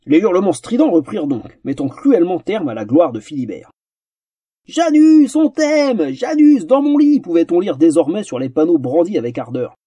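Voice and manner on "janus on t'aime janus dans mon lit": very high, loud adoring shrieks; fan screams. Acted. Girls in the crowd scream their love for Janus. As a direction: squeal like a smitten fan. Fans' placards voiced as screams.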